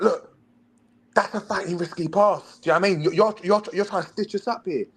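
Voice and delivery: high mock coach, lecturing; angry coach. Coach telling player the pass is risky, high mock lecture. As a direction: lecture him like a worked-up coach. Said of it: "He's like".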